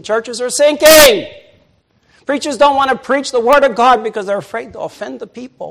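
sermon warning; preaching. Acted. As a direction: warn the church from the pulpit. Preaching at full force.

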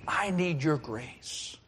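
slow, soft plea to God; gentle, drawn-out petition; hushed prayer. Acted. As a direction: pray it quietly and slowly. Acted prayer before temptation.

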